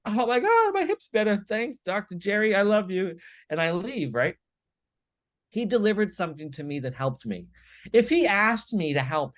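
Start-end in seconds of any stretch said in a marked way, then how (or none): 0.0-3.2 high, loud, overjoyed thanks; gushing relief. Retold or imitated. Patient thanking Doctor Jerry after an adjustment, loud, high gushing. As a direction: burst out with relieved joy.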